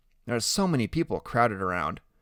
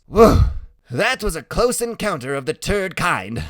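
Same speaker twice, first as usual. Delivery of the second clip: loud, high-pitched whoa and quip; exclaiming joker; 7 semitones higher, 8 dB louder than usual. A narrator's reading. Freak joking after a close escape, loud and high. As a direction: crack the joke in a raised, excited voice.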